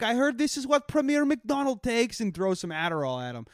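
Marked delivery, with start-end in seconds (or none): 0.0-2.2 high put-on comic foreign voice; mock foreign dignitary. Joking about Borat mocking Trump, high put-on comic voice. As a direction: ham it up like a clueless visiting character. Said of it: "be like".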